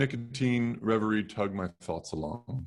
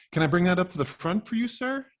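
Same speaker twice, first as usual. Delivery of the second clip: high, fast, eager helper voice; perky young shop girl; 11 semitones higher than usual. Acted. Girl employee offering to carry the ham, high and perky. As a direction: bounce it up like a chipper teenager.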